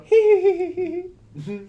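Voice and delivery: high mock coy giggle; blushing giggle. Imitating the wife blushing at dirty talk, mock giggle. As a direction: imitate a wife's high, squealed hee-hee. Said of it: "she'll blush and go".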